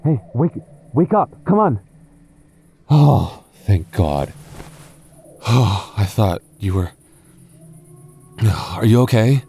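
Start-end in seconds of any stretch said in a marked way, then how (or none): none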